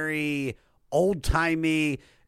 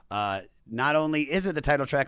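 ordinary delivery

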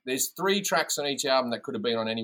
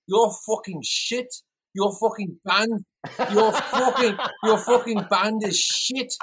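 high, slow, drawn-out rock-star insult; 7 semitones higher, slower than usual. Retold or imitated. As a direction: mimic a British rocker, stretching each word. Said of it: "such a good fucking impersonation of them".

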